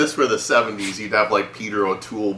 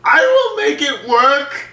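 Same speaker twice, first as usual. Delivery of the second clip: slow, high, loud hammy yell; mock yell; 7 dB louder, slower than usual. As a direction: bellow it like an overacting villain. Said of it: "He's like".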